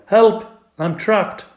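high, loud squeak for help; squeaked yell. A narrator's reading. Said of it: "I squeaked".

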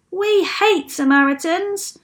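high, loud protest from the crowd; indignant shout. A narrator's reading. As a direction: call out the objection indignantly. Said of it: "shouted another".

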